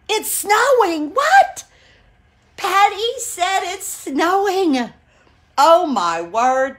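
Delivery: high, drawn-out disbelief; loud, astonished squeal, stretching the words; amazed. Real, not acted. Vlogger reacting to a viewer's snow report, amazed.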